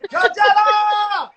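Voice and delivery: long high drawn-out Coachella; mock festival cheer. Joking the kids' chaos is like Coachella, high drawn-out cheer.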